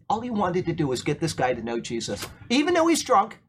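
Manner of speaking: normal talking voice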